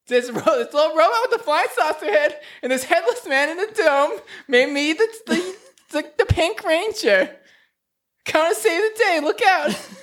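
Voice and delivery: very high, panicky granny voice; old Mildred. Acted. Grandma Ranger calling her grandson from a volcano, high and panicky. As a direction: cry for help like a frantic old lady. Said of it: "She's like".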